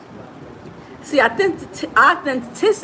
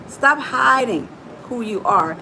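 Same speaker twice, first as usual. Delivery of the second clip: normal talking voice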